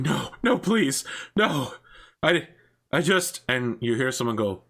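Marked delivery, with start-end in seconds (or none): 0.0-3.4 higher, pleading voice; frightened plea; begging for mercy. Acted. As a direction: beg the man to stop. Victim begging the mob boss.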